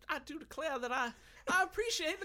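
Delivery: very high Southern belle voice; Southern belle accent. Acted. Granted leave to speak Southern, he goes high Southern belle. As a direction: fan yourself and coo it like a Southern belle. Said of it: "permission to speak as a Southerner".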